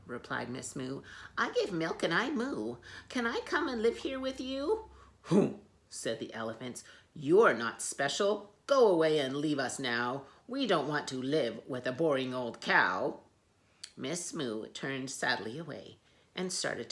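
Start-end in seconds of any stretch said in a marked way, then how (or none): 1.4-4.9 much higher, timid cow. Acted. Miss Moo asks. 5.2-5.6 loud dismissive snort; loud scoffing hoo. Acted. Elephants scoff. 7.1-13.2 loud, rude dismissal; rude elephants. Acted. Elephants send her away.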